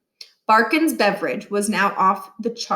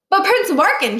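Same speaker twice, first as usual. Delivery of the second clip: put-on higher, louder girl's objection; protesting girl; 9 semitones higher, 6 dB louder, faster than usual. A narrator's reading. Student objecting to the new principal, high and loud. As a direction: stand up and protest.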